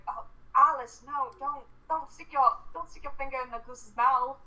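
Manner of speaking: a lightly British dad scolding his daughter; British accent. Retold or imitated. Dad warning his daughter off the goose, in a British accent. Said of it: "realizing he should not be speaking British".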